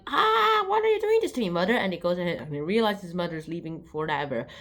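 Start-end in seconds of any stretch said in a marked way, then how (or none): 0.1-1.7 high pleading puppy whine; whiny puppy. Acted. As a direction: whimper it up high like an abandoned pup. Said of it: "he's all like".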